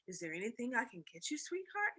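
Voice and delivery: soft, tender dad; gentle father. Acted. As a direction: comfort a sad daughter. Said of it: "he asked".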